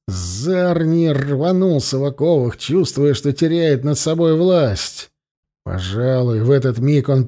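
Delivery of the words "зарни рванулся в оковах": plain reading voice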